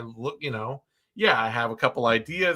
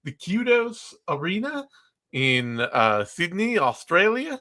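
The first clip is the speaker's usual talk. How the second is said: a bad, high Australian accent pushed upward; terrible Australian accent; 4 semitones higher than usual. Podcast host naming the fight venue in a terrible Australian accent. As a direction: ham up a clumsy Australian accent on the city name. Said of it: "That's my terrible Australian accent".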